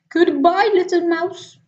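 a snake caught off guard; alarmed snake. Acted. Owl leaves.

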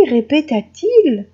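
usual speaking voice

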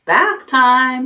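high, bright call of bath time; cheerful. A narrator's reading. Zuckerman cheerfully starting Wilbur's buttermilk bath, high call. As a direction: announce it with glee. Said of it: "said Zuckerman cheerfully".

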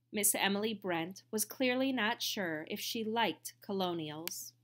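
ordinary delivery, nothing marked

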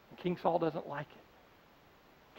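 a slow, ominous beat; slow, dark aside. A narrator's reading. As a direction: let it land slowly and quietly. Dramatic aside.

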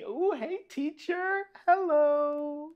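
very high, sing-out hello; playful high greeting. Pretend. Reader greeting the teacher in the book, high and playful.

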